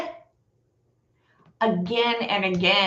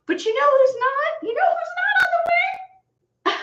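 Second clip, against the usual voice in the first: sing-song, much higher taunting question; mocking high voice. Real, not acted. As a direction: needle them with a high, teasing lilt. Mocking rhetorical question to viewers.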